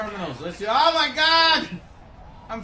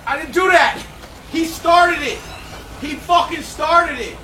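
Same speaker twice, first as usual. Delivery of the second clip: loud protest; 3 semitones higher, 7 dB louder than usual. Real, not acted. Protesting after angering crab.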